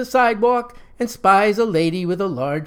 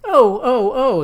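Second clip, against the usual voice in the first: loud high yelps of pain; 7 semitones higher, 6 dB louder, slower than usual. A narrator's reading. Curly yelps loudly while Moe twists his nose. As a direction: cry out as your nose is twisted. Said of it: "exclaims Curly".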